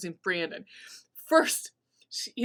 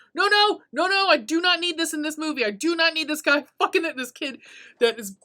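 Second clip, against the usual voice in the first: higher, louder panicked pleading; alarmed protest; 7 semitones higher, 5 dB louder than usual. Retold or imitated. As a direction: beg the movie to stop, mock horror. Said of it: "I'm like".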